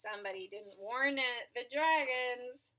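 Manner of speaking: ordinary delivery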